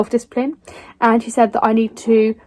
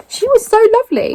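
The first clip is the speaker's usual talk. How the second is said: gushing; 11 semitones higher, 3 dB louder, faster than usual. Real, not acted. Praising the author.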